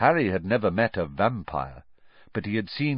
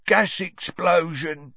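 slow, higher-pitched grunt; 9 semitones higher, 4 dB louder, slower than usual. A narrator's reading. Ern supplying the cover story to Stan, grunting. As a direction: grunt the answer, drawing it out. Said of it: "grunted Ernie".

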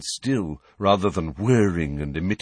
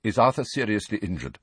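sharper voice. Acted. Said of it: "asked in a new and sharper voice".

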